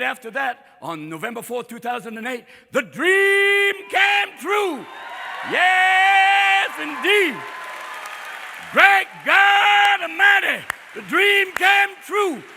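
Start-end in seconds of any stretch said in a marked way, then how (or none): 2.7-12.4 high, loud, long-held vowels; exultant preaching. Acted. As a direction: proclaim it like a revival preacher, stretching key words. Preacher-like celebration of the election.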